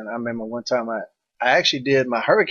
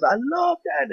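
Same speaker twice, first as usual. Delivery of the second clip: much higher, drawn-out gushing imitation; Pat Patterson; slower than usual. Wrestler retelling backstage praise after his accidental pose, high mock delight. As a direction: imitate someone loving it in a high-pitched voice. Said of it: "Pat Patterson goes".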